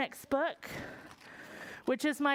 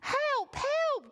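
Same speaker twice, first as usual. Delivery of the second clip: crying page; 13 semitones higher than usual. Acted. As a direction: call out in a panic, high. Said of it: "cried the page".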